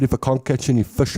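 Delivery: usual speaking voice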